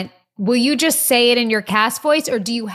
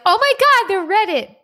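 much higher, delighted squeal; excited; 12 semitones higher, 3 dB louder than usual. Retold or imitated. Remembering the Reddit, delighted squeal. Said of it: "I'm like".

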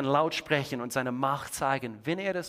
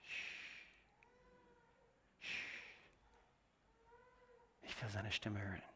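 shushing then whispering, much softer; whispered shush; hushing whisper; 19 dB quieter than usual. Shown as a demonstration. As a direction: hush the room to listen. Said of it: "Wo wir sagen müssen".

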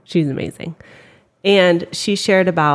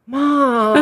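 put-on whiny kid protest; 8 semitones higher than usual. Kid protesting, voiced by mother.

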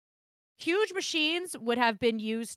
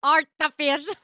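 mock Russian accent; 5 semitones higher, 7 dB louder than usual. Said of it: "in an entire Russian accent".

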